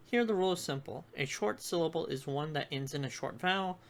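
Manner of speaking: normal talking voice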